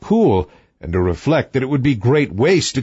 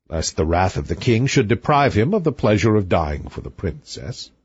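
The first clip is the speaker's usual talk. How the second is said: ordinary delivery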